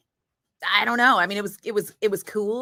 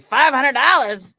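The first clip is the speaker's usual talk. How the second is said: louder, slower, eager gasp at the money; retold excitement; 6 semitones higher, 8 dB louder, slower than usual. Traveler hearing the airline's cash offer, excited and loud. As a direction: jump at the offer, drawing it out. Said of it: "I was like".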